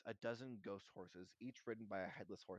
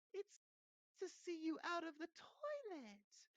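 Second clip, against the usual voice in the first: slow, very high fake cheer; falsely bright; slower than usual. A narrator's reading. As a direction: overdo the friendliness, high and drawn out. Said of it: "in a falsely bright voice".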